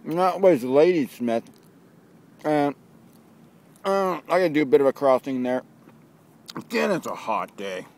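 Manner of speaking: cheeks stuffed full while talking; chewing between words; mouth full. Real, not acted. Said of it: "Started talking with my mouth full".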